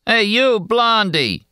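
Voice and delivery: high, weary heckling call; high, tired voice. A narrator's reading. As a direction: call out over and over, bored and mocking. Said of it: "in a high, tired voice".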